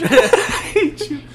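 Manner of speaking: laughing. Real, not acted. After eating hot sauce.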